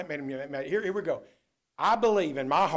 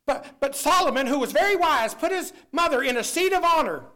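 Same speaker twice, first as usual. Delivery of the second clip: a touch higher, indignant lady; mock lady objecting; 7 semitones higher than usual. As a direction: lightly imitate the offended churchgoer. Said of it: "and she said".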